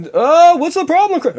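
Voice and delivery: very high, loud frantic voice; hammed-up coked voice. Jerry greets Kramer, very high and frantic. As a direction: blurt it wired.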